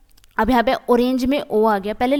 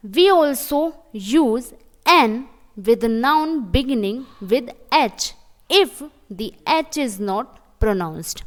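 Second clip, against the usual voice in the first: very slow, word by word; slow rule reading; much slower than usual. Shown as a demonstration. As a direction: dictate the rule to the class. Teacher reads rule slowly. (नियम बहुत धीरे पढ़ना)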